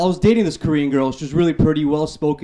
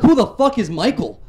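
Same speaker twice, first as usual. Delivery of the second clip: indignant comeback; 7 semitones higher, 3 dB louder than usual. Retold or imitated. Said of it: "I'm like".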